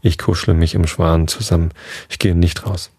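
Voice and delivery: ordinary delivery, nothing marked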